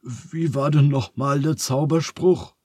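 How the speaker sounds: rattled, pitch lifted; aghast. A narrator's reading. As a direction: ask it in mild dismay. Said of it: "fragt Phineas entgeistert".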